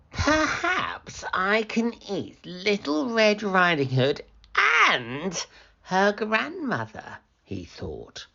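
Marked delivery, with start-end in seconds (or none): 0.1-7.2 raised, sly wolf voice; hungry wolf thinking aloud; scheming wolf. Acted. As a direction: plot the next meal gleefully.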